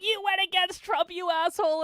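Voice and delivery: hammed-up outraged followers; loud, high mock accusation; mock angry fans. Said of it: "They're like".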